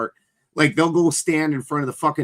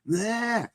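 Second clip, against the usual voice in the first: echoing goat bleat; 8 semitones higher, 5 dB quieter than usual. Retold or imitated. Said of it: "the echo would come back".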